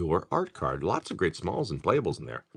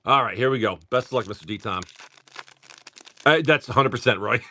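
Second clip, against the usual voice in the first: normal talking voice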